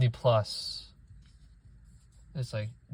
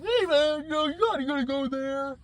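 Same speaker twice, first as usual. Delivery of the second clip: much higher, goofy dude voice; 13 semitones higher than usual. Retold or imitated. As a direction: parody a clueless bro.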